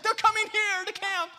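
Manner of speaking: very high, loud squeal of news; excited reply. Retold or imitated. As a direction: play the thrilled student, at full pitch. Student excitedly answers in retold exchange.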